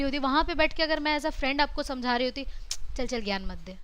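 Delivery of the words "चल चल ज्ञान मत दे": low, soft dismissive mutter; dismissive brush-off. Retold or imitated. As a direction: wave off the advice lazily. Friend brushing off advice. (उपेक्षा भरा धीमा जवाब)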